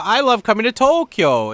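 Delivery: "Tokyo" said the Japanese way, To-kyo, high and bright; mock Japanese pronunciation of Tokyo. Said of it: "John Dobb likes to say Tokyo".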